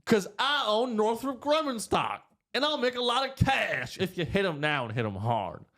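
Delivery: high, slowed mock-senator voice bragging about stock; Lindsey Graham impression. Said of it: "Lindsey Graham".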